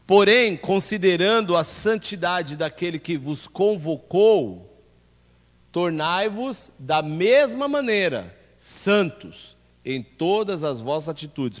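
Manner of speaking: loud, slow, emphatic Bible reading; scripture reading. Acted. Pastor reading First Peter to the church, proclaiming. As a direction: proclaim the verse with weight and spaced phrases. Reads scripture aloud to congregation.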